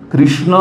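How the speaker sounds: कृष्ण as "krishna", with an i vowel; variant pronunciation. Acted. Pandit asking which is right, offering "krishna". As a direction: say कृष्ण with a clear "ri". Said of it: "क्या सही है".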